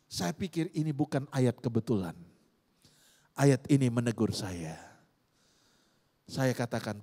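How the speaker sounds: ordinary talk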